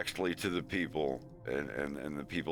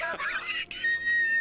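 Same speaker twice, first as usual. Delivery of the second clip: high, loud burst of laughter; shrieking laugh; 5 dB louder than usual. Real, not acted. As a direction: let a shrill laugh explode. Laughing at retold call.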